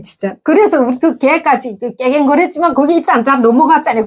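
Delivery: usual speaking voice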